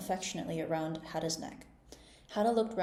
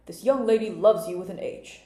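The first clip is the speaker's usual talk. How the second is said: king voice; 10 dB louder than usual. A narrator's reading.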